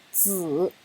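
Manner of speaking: 子 slowly in full third tone; 子 in its citation third tone. Shown as a demonstration. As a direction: draw out 子 as a full dipping tone. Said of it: "它正常的声调是三声".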